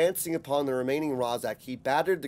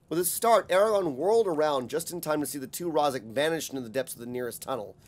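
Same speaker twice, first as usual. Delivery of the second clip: ordinary delivery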